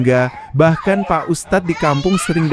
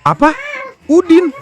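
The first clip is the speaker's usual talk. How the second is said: high, louder shocked husband; 5 dB louder than usual. A narrator's reading. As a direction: gasp it out in disbelief. (suami terkejut, suara tinggi)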